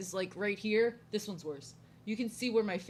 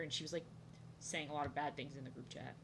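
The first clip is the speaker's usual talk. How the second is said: ordinary talk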